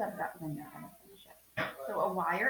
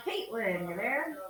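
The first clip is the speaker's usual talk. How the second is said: higher, louder roll-call question; 5 semitones higher, 4 dB louder, slower than usual. Real, not acted. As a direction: call out to see if they are there. Roll call, calling a student.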